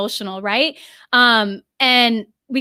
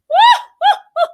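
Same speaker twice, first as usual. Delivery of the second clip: a sudden high burst of laughter; high emotional laugh; 6 dB louder than usual. Real, not acted. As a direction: laugh out the overflowing joy.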